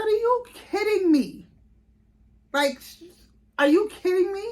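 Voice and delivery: shocked and thrilled at once; stunned disbelief. Retold or imitated. Stunned at surprise birth.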